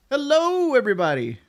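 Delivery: hammed-up cheery streamer hello; streamer greeting voice. Mock-greeting an imagined stream audience, loud and high. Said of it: "I did that voice so casually".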